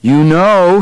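excited exclamation. A narrator's reading. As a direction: begin an excited group cry. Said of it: "like a chorus, everyone in the control room shouted".

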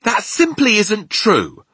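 high, loud, slow indignant protest; angry cry. Acted. Sophie contradicting the giant, angry cry. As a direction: protest hotly, stretching each word. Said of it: "Sophie cried angrily".

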